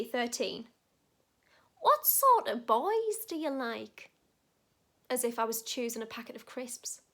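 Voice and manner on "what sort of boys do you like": lightly voiced nana, slow and higher; nana asking. Nana asks her grandchild about boys, slow lilting question. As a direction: draw out the question with a lilt. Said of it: "asked me".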